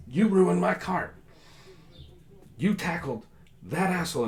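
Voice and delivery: very high, loud, outraged shout; furious merchant. Acted. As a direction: yell right in the guard's face. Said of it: "He's like".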